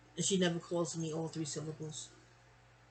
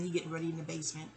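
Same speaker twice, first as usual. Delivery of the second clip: ordinary talk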